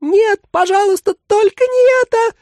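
shrill creature's desperate exclamation; exclaiming creature. A narrator's reading. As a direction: cry out in horror. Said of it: "воскликнул сиреневый манекен".

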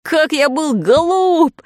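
high, loud, drawn-out exclamation; boy's shout. A narrator's reading. Boy realising his mistake, loud high cry. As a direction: cry out in sudden self-reproach. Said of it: "закричал Фред".

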